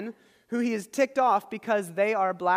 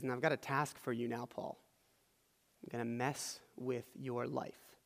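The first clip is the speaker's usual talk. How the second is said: low, much softer, confidential voice; quiet, understated; 8 semitones lower, 12 dB quieter than usual. Acted. Jesus hands Paul a life-changing task, low and understated.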